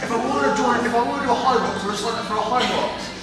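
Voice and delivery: mocking quote. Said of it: "Holly was like".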